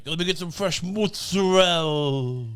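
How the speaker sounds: "mozzarella" said as mutsarel, Italian-style with a long rolled middle; slow, hammed-up Italian deli order; exaggerated Italian mozzarella. Mocking an idiot ordering in the deli, in a hammed-up Italian accent. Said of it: "the idiot going".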